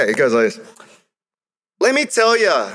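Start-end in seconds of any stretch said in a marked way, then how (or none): 1.8-2.7 high, punchy call to the crowd; spoken word kick-off; slam poetry opener. Acted. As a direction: launch the poem with swagger. Poem performance opening.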